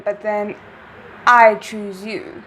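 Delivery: normal talking voice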